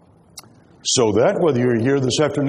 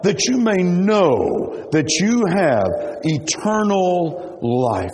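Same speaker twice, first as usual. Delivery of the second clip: "know" drawn out slowly and raised; stress on KNOW; 4 semitones higher, much slower than usual. Shown as a demonstration. Preacher showing the congregation which word to underline, "know" emphasized. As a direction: lean hard on "know", stretching the verse out. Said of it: "you need to emphasize the word".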